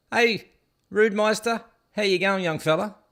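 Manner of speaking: mock caller. Caller mimicked on phone.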